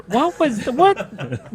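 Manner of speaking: laughing through the words; breaking into laughter mid-question. Real, not acted. Laughing at the puking sounds remark.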